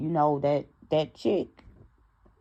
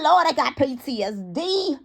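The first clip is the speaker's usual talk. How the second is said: dramatic cry; 10 semitones higher, 6 dB louder than usual. Real, not acted. Dramatic exclamation.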